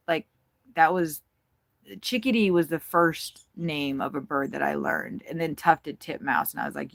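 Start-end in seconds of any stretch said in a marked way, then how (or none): none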